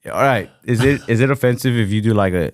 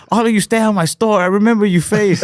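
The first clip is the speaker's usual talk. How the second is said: pissed-off comedian; 13 semitones higher, 3 dB louder than usual. Retold or imitated. The comic turns on the booing crowd, hammed-up mock fury. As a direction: snap at the crowd like an insulted shopkeeper. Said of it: "the dude just gets pissed".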